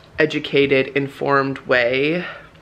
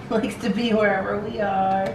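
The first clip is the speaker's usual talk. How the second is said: pet talk; 5 semitones higher than usual. Real, not acted. Talking to the dog.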